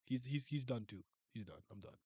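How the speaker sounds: normal talking voice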